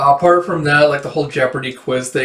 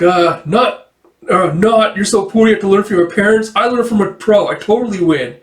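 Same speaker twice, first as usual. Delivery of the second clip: put-on higher, quick childish one-upmanship; mock bragging; 6 semitones higher, 4 dB louder than usual. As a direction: boast as if winning a kids' argument. Acting boastful one-upping in squabble.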